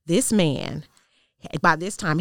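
ordinary talk, nothing marked

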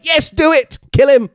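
loud, high-pitched egging on; excited cheering. Retold or imitated. Viewer reliving urging Wanda to attack Hayward, excited shout. Retelling own cheering at the screen.

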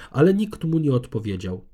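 ordinary delivery

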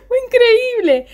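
words squeezed through laughter, high and slow; laughing while talking. Real, not acted. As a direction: keep laughing as you speak.

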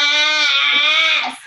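a high, loud cry of yes; excited yes. Real, not acted. Host cheers the guest's decision, excited shout. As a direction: cheer her on with a burst.

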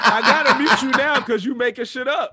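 loud, high laughing threat. Real, not acted. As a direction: threaten while cracking up. Laughing mock threat to mute.

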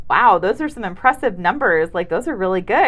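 loud, impressed surprise; mock amazement at the numbers; surprised nurse. Nurse reading blood pressure results, surprised. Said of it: "she's talking like this, like, really surprised".

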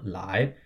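"lai" sweeping up at the end; second-tone demo. Shown as a demonstration. Teacher models the syllable just spelled, second-tone demo. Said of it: "L A I in the second".

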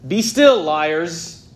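booming divine command; commanding rebuke. Shown as a demonstration. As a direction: rebuke the raging nations. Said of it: "God is confronting the rebellious nations".

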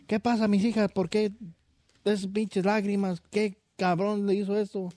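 mother asking who made her daughters cry; high mother voice. Retold or imitated. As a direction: demand it like an angry village mother.

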